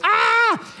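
high, sharp shriek of alarm; retold shriek. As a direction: let out a startled shriek. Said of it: "She's like".

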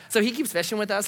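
ordinary delivery

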